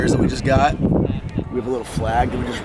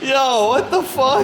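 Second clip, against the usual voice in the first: laughing disbelief; 13 semitones higher, slower than usual. Real, not acted. Reacting to failed lift.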